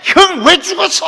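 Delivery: calling a dead brother in a high shout; re-enacted anguished holler; grieving yell. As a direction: cry out loudly, demanding an answer. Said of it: "소리 지르면서".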